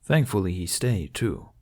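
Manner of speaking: ordinary delivery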